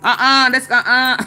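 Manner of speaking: playful no-thanks; dismissive refusal. Retold or imitated. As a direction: wave off the family group chat. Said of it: "I be like".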